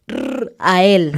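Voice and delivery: Israel chopped into separate sounds; mock a nervous learner spelling out Israel; Is-r-a-el, each sound stiffly apart; over-careful learner. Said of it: "van a decir algo como".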